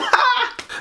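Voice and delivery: squeaky put-on laugh; high-pitched cackle. Know-it-all stranger finishing his spiel, high cackle.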